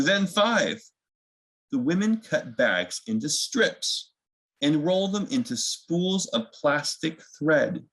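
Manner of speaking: gentle storybook reading, unhurried; reading to children. A narrator's reading. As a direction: read it like bedtime story time for little ones.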